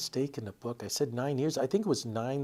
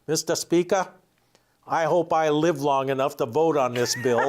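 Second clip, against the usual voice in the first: louder, mock-formal address to the Speaker, laughing as he goes; retold old legislator quip; 3 semitones higher, 5 dB louder than usual. Retelling the colleague's floor quip to the host, loud and laughing. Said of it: "He grabs his microphone and goes".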